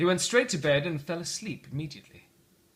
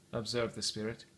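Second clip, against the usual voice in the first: ordinary delivery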